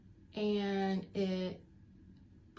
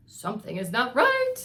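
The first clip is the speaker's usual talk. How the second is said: loud, urgent alarm in the night; alarmed call; 3 semitones higher, 10 dB louder than usual. Acted. Nurse waking up in the night, crying out in alarm. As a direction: call it out sharply as trouble is sensed. Miss Clavel senses trouble at night.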